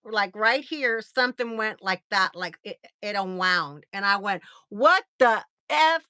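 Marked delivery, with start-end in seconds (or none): 4.7-6.0 slow, loud, higher exclamation; loud outcry. Retold or imitated. Patient feels the tendon unwind, loud shocked outcry. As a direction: punch each word out loudly in shock. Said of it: "I said it, like, loud".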